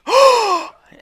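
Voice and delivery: sharp high loud gasp; little sister's loud shocked gasp. Retold or imitated. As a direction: gasp like a kid catching something. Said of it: "I heard her go".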